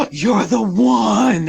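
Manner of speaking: slow, loud, fateful pronouncement; dramatic aunt. Retold or imitated. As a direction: announce it like a prophecy, slow and loud. Aunt reacts after gasp.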